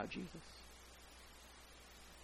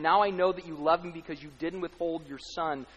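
normal talking voice

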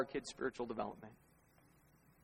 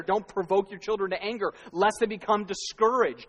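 verse repeated louder and pressing; emphatic scripture; 5 semitones higher, 7 dB louder than usual. Retold or imitated. Pastor driving the verse home to parents, louder and insistent. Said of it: "how is right there".

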